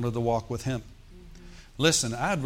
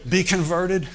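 louder, higher call to repent; mock street preacher; 5 semitones higher, 4 dB louder, slower than usual. Pastor imagining himself preaching at coworkers, loud mock street call. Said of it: "get a megahorn and say".

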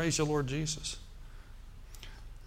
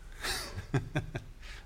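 soft laugh; 9 dB quieter than usual. Real, not acted. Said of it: "That's even worse than poor".

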